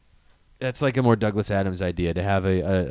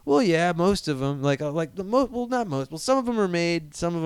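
hedging alien; 7 semitones higher than usual. Acted. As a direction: backpedal awkwardly.

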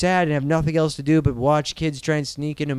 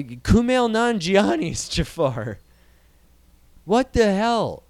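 usual speaking voice

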